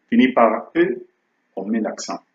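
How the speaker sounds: normal talking voice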